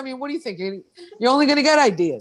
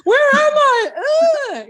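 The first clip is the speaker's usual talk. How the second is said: high, loud bewildered cry; like waking up lost in the woods; dazed and confused; 11 semitones higher, 4 dB louder, slower than usual. Retold or imitated. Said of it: "I'm like".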